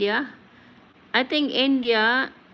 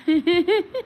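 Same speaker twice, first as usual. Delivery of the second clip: quick high burst of laughter; real amused laugh; short high laugh; 8 semitones higher, 5 dB louder than usual. Real, not acted. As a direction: laugh out at the comment. Laughing at a viewer comment.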